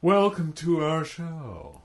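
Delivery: slow, drawn-out mock host welcome; put-on slow show-host voice; talk show host. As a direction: open the show with stretched affected vowels. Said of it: "a talk show kind of thing".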